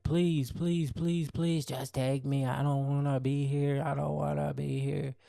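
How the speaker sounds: high pleading wail, imitating her; hammed-up suicidal plea, pitched high; mock desperate screaming. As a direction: beg in a high mock-hysterical voice. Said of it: "she just keeps screaming".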